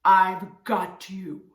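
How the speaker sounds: loud, slow lion voice; roaring lion. A narrator's reading. Lion catching the mouse, slow loud roar. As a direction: roar it slowly in triumph. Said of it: "roared the lion".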